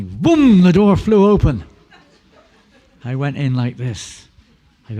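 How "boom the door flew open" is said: loud, high exclamation; explosive, pitched well above normal talk; sudden boom. Retold or imitated. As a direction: burst out with a booming storyteller's shout. Acting out door suddenly bursting open.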